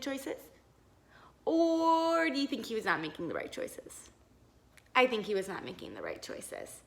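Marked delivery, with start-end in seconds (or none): none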